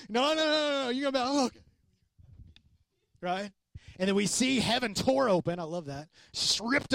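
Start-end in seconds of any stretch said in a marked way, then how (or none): 0.1-1.6 a lightly hammed-up John the Baptist, high and protesting; high-pitched humble protest; flustered John. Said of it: "John's like".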